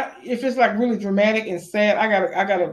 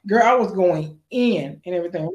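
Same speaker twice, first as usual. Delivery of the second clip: ordinary talk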